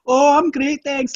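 high, chirpy mock American accent. Mocking the actress's bad American accent, high and chirpy. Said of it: "your American accent".

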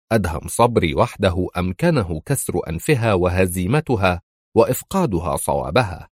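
usual speaking voice

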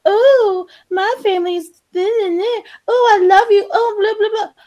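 high, gushing mock post voice; mock sappy social media gush; mocking gushing poster. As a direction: mimic an oversharing poster, sugary and high. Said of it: "and it's like".

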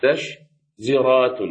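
مستشزرات very slow, broken up with pauses between syllables; heavy pronunciation. Shown as a demonstration. Teacher showing a word is hard to say, مستشزرات broken up slowly. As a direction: chop مستشزرات into slow separate pieces. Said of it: "هذه الكلمة ثقيلة في نطقها".

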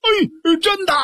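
high, excited squeal of relief; overjoyed. Acted. The crying brother suddenly delighted by the plan, overjoyed. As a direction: jump at the offer, voice leaping up. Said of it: "乐得直点跳脚".

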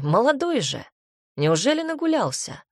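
high teasing jab; smirking. Acted. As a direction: tease him with a smirk. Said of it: "хмыкнул папа с ухмылкой".

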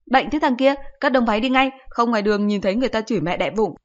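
high, loud irritated snap; a mother telling her son off; shrill-free loud mother scolding. Acted.

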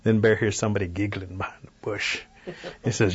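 usual speaking voice

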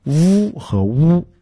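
first "呜" buzzing v, second "呜" rounded oo; v against w. Shown as a demonstration. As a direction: contrast "呜和呜" slowly as v and w. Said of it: "是分开的".

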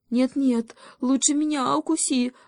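put-on high-pitched kid goat intervening; pleading little goat. A narrator's reading. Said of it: "заступился козлёнок за Машеньку". (просящий высокий голосок козлёнка)

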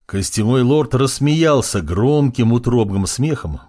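usual speaking voice

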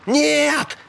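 very high, squealed cry; high yelp of panic; panicked squeal. A narrator's reading. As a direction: squeal it in alarm, pitch leaping up. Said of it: "взвизгнул Мальком".

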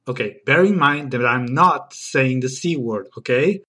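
ordinary talk

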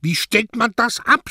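high, loud startled cry; panicked shout. Acted. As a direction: yell it out in a panic. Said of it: "schrie Mario".

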